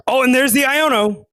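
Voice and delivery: sudden high, loud call-out; excited. Real, not acted. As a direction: jump up at the surprise. Surprise play in the match.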